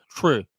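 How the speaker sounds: "tree" with a lax vowel, like "troo"; accented mispronunciation. Retold or imitated. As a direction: imitate a foreigner's slack-mouthed "tree", said as "truh". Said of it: "They don't say tree".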